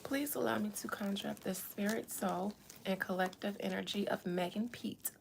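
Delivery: hushed seance whisper. Pretend. Mock seance conjuring.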